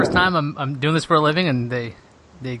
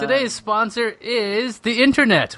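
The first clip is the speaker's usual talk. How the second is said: mock announcer; 7 semitones higher, slower than usual.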